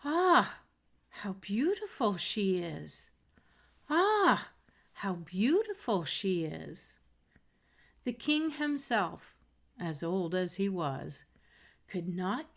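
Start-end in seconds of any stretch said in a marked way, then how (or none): 0.0-7.0 awed onlookers, raised and lifted; admiring courtiers. Acted. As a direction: voice a crowd marvelling at her. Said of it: "voices saying".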